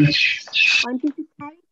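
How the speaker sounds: choppy, dropping-out online audio; radio breaking up. Real, not acted. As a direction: voice cutting in and out. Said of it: "কথা কেটে কেটে যাচ্ছে".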